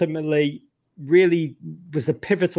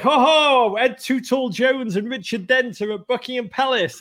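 high, jolly mock crowing; imitated crowing merriment; 8 semitones higher than usual. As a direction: crow it in a raised, gleeful voice. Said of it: "they were like".

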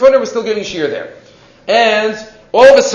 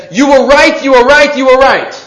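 higher, louder, urgent repetition; excited insistence; 7 semitones higher, 7 dB louder than usual. Retold or imitated. Armless man telling the rabbi he was right, excited. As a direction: press it home, he was right.

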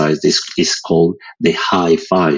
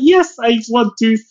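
high mock voice. His own reply in quote.

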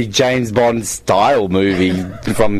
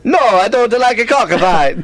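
put-on Italian accent; 11 semitones higher than usual. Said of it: "Italian accent".

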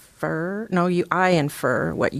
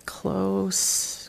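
whispered to herself; whispering; 2 semitones higher, 7 dB quieter than usual. Real, not acted. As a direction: breathe the word, unvoiced. Muttering answer to herself.

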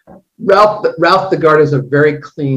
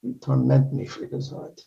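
Khan impression; 7 dB quieter than usual. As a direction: hiss it like a movie villain. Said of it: "we had him do Wrath of Khan stuff".

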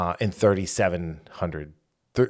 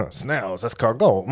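hammed-up French accent, lifting at the end; bad French accent; 2 semitones higher than usual. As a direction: toss out food words in a mock French accent. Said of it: "I can't do a French accent".

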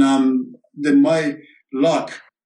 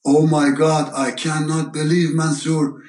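put-on higher, amazed voice of an old friend; imitating his friend's shocked delight, pitched up; excited friend's greeting; 5 semitones higher than usual. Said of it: "And Nasty goes".

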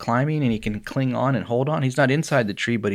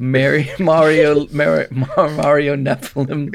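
louder, higher Mario voice; hammed-up Mario, higher and loud; joking Mario impression, pitched up; 4 semitones higher, 6 dB louder, slower than usual. Keeps Mario voice, laughing.

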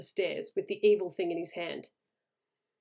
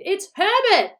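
very high, loud scream of recognition; 12 semitones higher, 9 dB louder, slower than usual. A narrator's reading. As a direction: shriek it out frantically. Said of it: "she screamed".

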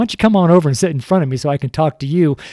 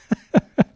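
a short sheepish chuckle; embarrassed laugh. Real, not acted. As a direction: laugh at your own stumble.

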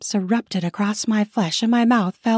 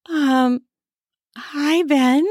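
higher, hesitant voice; flustered greeting; 6 semitones higher, much slower than usual. Acted. Wife caught out greets the neighbor, flustered. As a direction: answer haltingly, pitched up.

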